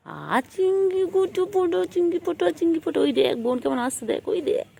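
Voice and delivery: high, loud sing-song coaxing; high pet talk. Real, not acted. High teasing calls to pet. (উঁচু সুরে আদুরে ডাক)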